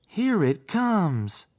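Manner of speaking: tiny frog to himself. A narrator's reading. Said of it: "he said to himself".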